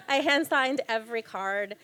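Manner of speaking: half-laughing, pleased with herself; giggly proud admission. Real, not acted. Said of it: "oh, that's so cute".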